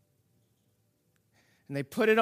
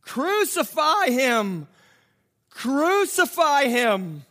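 crowd's cry; 6 semitones higher, 5 dB louder, slower than usual. Acted. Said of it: "they cried out".

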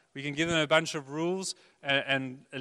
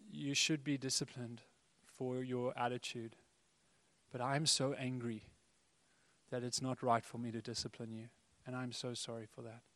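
tender apology; 9 dB quieter than usual. Retold or imitated. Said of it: "I had to say to my children".

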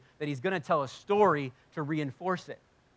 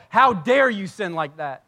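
loud, drawn-out mock outrage; indignant rebuke; 3 semitones higher, 9 dB louder, slower than usual. Forgiven believer accusing another sinner, indignant. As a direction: scold with raised voice, hammering it slowly.

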